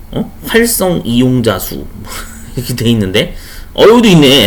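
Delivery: usual speaking voice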